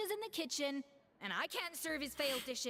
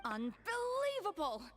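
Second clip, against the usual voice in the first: higher, slow, indignant Unbelievable!; outraged exclamation; 8 semitones higher, slower than usual. Acted. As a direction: draw it out in disbelief. Chef outraged by sugared wrapper.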